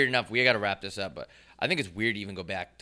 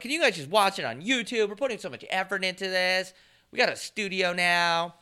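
pleading; 8 semitones higher than usual. Retold or imitated. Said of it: "I'm like".

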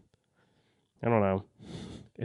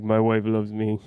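mock Russian accent. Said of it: "That's, like, Russian".

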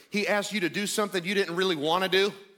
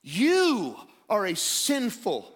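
raised, emphatic sermon proclamation; fervent pulpit declaration; impassioned preaching; 3 semitones higher, 3 dB louder, slower than usual. Real, not acted. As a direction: build in pitch as you declare his majesty. Preaches Christ's exalted status.

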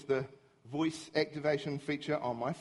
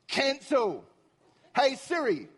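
higher, fed-up voice command; acted impatience, pitched up; exasperated command; 7 semitones higher, slower than usual. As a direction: order the useless phone to start over. Frustrated commands to Siri.

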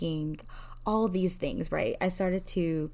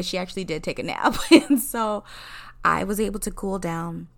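ordinary delivery

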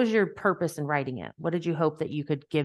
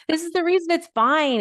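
mock breezy; 11 semitones higher, 5 dB louder than usual. Laughing mock justification.

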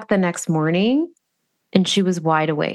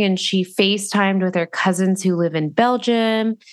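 ordinary talk, nothing marked

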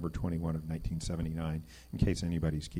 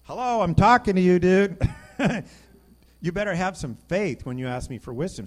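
loud, high wake-up call with a laugh; God calling out; 11 semitones higher, 13 dB louder than usual. Retold or imitated. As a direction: shout it like God getting his attention.

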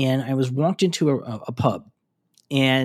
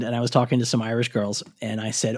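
ordinary delivery

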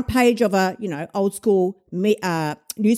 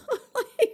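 high laugh; 14 semitones higher, 5 dB quieter than usual. Real, not acted. Bursts out laughing.

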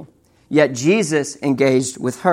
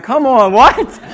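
high-pitched, louder mock shock; retold disbelief; 8 semitones higher, 5 dB louder, slower than usual. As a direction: act out stunned protest, ending on a high question. Retold shock at twins news.

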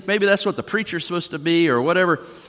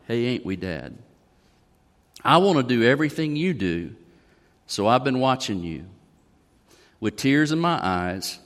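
tender lyric reading, voice dropping low; reading song lyrics; 4 semitones lower than usual. Acted. Pastor reads country song lyrics aloud.

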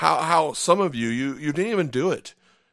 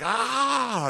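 high sudden groan of regret; a high yelp of dismay; high-pitched frustrated ah; exasperated cry; 11 semitones higher than usual. Retold or imitated. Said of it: "you're like".

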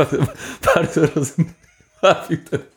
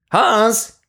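calling out; 8 semitones higher than usual. Acted. Soldiers call out across trenches.

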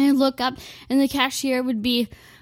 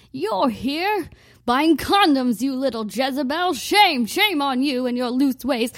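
scolding grandma; 3 semitones higher, 4 dB louder than usual. Retold or imitated. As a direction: scold her like a scandalized grandma. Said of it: "my grandma would come up to me and be like".

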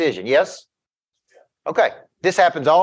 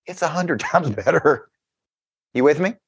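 laughing. Retold or imitated. Calling friend a week later.

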